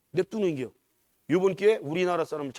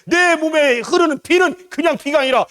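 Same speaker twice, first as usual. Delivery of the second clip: very high, loud, ringing proclamation; climactic preaching; 13 semitones higher, 14 dB louder than usual. Real, not acted. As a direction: cry out the faith at full force.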